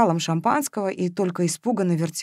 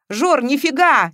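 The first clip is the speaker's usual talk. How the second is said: loud, higher, drawn-out protest; exasperated, raised-voice objection; heated outburst; 7 semitones higher, 5 dB louder, slower than usual. A narrator's reading. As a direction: burst out, unable to hold it in. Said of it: "не выдержал Матвеев".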